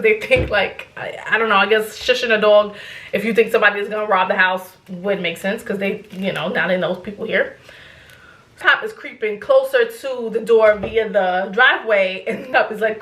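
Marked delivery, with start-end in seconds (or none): none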